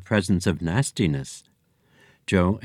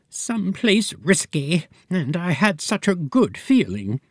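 much higher, slow fretful voice; anxious fortune teller; 9 semitones higher than usual. Acted. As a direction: fret as the nervous seer. Fortune teller's worried reply.

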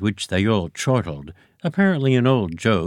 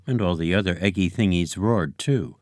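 usual speaking voice

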